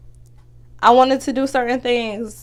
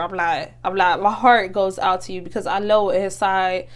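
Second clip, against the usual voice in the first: ordinary talk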